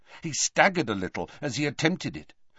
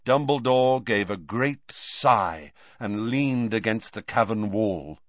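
ordinary delivery

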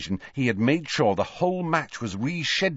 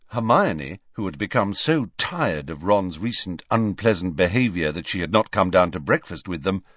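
ordinary delivery, nothing marked

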